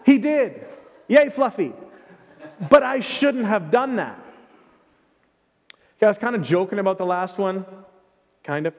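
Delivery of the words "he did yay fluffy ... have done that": mock cheer.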